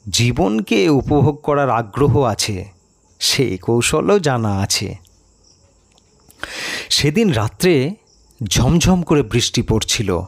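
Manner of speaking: usual speaking voice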